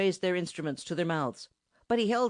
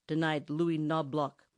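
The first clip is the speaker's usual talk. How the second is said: ordinary delivery, nothing marked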